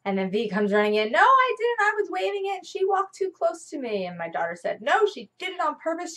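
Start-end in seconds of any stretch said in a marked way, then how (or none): 1.1-4.1 put-on much higher, louder little-kid denial; high child voice, defensive; four-year-old protesting. Mother voicing the four-year-old defending herself. 4.8-6.1 higher, lightly voiced indignant child; mild child's accusation; daughter accusing. Mother voicing daughter's accusation.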